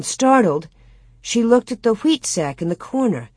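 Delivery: ordinary delivery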